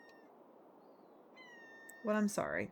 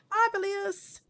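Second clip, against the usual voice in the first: cheerful greeting; 12 semitones higher, 6 dB louder, slower than usual. Acted. Greeting a visiting friend.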